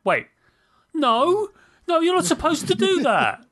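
high, indignant mock yell; yelling at screen. Player to the game over an unexpected move, mock yelling. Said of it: "me yelling at the screen".

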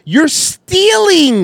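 drawn-out, high disbelief; incredulous. Real, not acted. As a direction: stretch it out in disbelief. Incredulous call-out of shoplifter.

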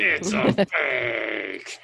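slow hammy meme quote; imitating meme line. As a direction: stretch it theatrically, breaking into laughter. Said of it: "what kind of emotion he's expressing".

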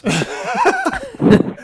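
very high-pitched laughing fit; squealing laugh. Real, not acted. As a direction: shrieks with laughter. Laughing at Brett's text.

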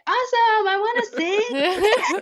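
high, slow and gushing with a laugh; a thrilled yes to the audition; eager excitement. Retold or imitated. Recalling her younger self gushing about singing at the audition. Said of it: "And I said".

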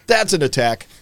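mock outraged Germany. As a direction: blurt it out as an exaggerated accusation. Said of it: "And Germany's like".

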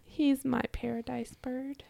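soft loving whisper. Real, not acted. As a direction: whisper it tenderly. Tender whisper about husband.

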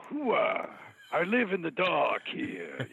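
high, drawn-out Pacino growl; slow Pacino voice with a shouted hoo-ah; Al Pacino impression. Shown as a demonstration. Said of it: "Al Pacino".

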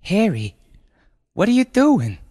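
high, loud, horrified mother; gasped alarm. Acted. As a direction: gasp it out in shrill disbelief. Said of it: "gasped Brenda".

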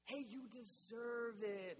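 slow, coaxing whisper-like tempter, lightly voiced; tempting inner voice. Pastor voicing the lie he tells himself, slow and coaxing. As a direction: drag it out like a sly temptation. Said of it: "a lie I love to tell myself".

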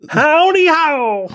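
very high, drawn-out cartoon squeak; sing-song Christmas poo greeting, slow and high; high, slow cartoon howdy ho; Mister Hanky voice. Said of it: "That's Mister Hanky".

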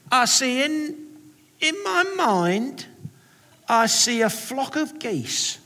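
high, slow, hammed-up Cockney; cartoon Cockney accent. Sunday school teacher sharing her vision, cartoon Cockney accent. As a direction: draw out each phrase as a cartoon Cockney seer. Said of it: "talking with what sounds like almost a kind of cartoon accent".

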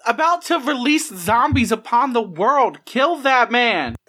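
high, slow menacing villain; sinister villain voice. Acted. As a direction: drag each word out with menace. Player voicing villainous character.

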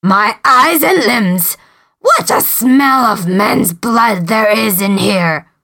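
slow, menacing giant. Acted. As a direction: sniff out blood like a giant. Giant smells men's blood.

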